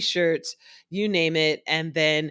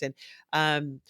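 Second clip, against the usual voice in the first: normal talking voice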